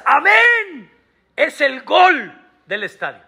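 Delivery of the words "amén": high, ringing cry of amen; shouted amen. Retold or imitated. Rabbi to congregation, a jubilant shouted amen. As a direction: call out amen like a stadium goal. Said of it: "Y cuando gritas".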